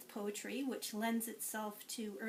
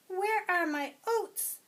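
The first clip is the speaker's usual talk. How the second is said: higher, louder indignant goat; 9 semitones higher, 8 dB louder, slower than usual. Acted. Teacher voicing the goat, higher and louder. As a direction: demand oats as a cross goat. Goat character quote in story.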